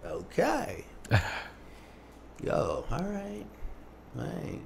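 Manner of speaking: very soft, with long gaps; hesitant acceptance. Retold or imitated. Dad absorbing his son's career news, quiet and hesitant. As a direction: take it in slowly, barely voicing it.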